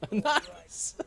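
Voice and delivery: very high, rising nice; high squeaky nice. Pretend. Reacting to censor beep.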